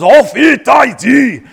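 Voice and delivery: high, slow, loud mock shout; imitating Russian translator shout. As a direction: belt it out like a sudden interpreter. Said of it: "he goes".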